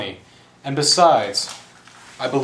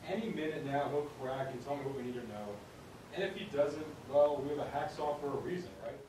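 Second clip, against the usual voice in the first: very faint, as if overheard; distant voice; 18 dB quieter than usual. Acted. Henchman to boss about captive, overheard from afar. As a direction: talk from well off, barely audible.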